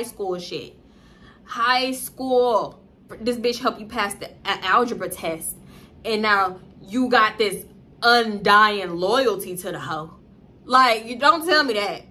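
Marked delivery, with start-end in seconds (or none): none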